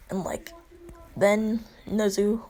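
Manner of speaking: ordinary talk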